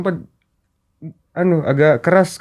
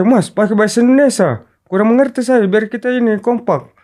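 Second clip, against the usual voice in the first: raised, higher-pitched mild scolding; louder, higher retold complaint to a teammate; retold irritation; 7 semitones higher, 4 dB louder than usual. Teammate asking another to speak Indonesian, mildly heated. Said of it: "sore saya emosi".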